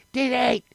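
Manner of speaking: short high chirp of mock effort; strong man noise. Strong man starting his rope act, high soft squeal.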